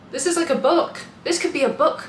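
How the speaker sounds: thrilled by juicy gossip; excited gasp. Real, not acted. Reacting to gossip.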